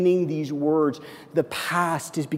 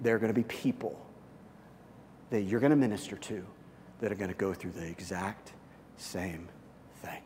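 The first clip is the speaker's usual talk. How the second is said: God's voice, hushed; 6 semitones lower, 14 dB quieter than usual. Retold or imitated. Pastor voicing God's gentle reply to him, hushed. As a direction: speak it like a quiet answer from above. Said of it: "And he said".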